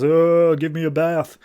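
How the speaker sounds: mock groan of a walking dead body; risen corpse. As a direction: groan it out like a corpse come to life. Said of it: "the body stands up and says".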